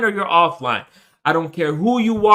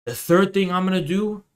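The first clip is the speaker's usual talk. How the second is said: normal talking voice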